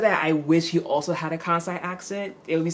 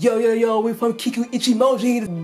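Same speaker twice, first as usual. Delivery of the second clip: louder, higher mock street swagger; 8 semitones higher, 6 dB louder than usual. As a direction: play a cocky homeboy showing off his turf, loud. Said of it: "they'd be like".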